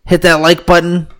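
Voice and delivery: high, hyped youtuber plug; mock YouTuber hype. Youtuber catchphrase parody.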